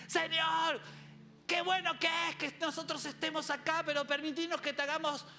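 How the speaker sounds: high, eager comic voice; Pedro. Acted. Disciple offering Jesus shelters, high eager pleading. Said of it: "Yo imagino a Pedro diciendo".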